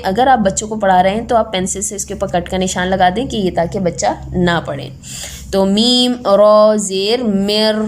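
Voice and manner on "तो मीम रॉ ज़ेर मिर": मीम रॉ ज़ेर, मिर said very slowly with no न; spelled slowly. Shown as a demonstration. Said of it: "मिन नहीं पढ़ेंगे".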